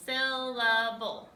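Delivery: "Syllable" slow and loud, in three separate beats; counted syllables. Shown as a demonstration. Teacher showing it moves three times, "Syllable" drawn out in three beats. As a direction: sound out "Syllable" part by part. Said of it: "Three times".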